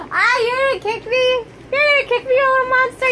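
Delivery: high, loud mock outrage at a kicking baby; teasing the little monster in a squeaky voice; playful mock alarm. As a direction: squeal it like she's shocked and delighted. Mom play-fighting with kicking baby.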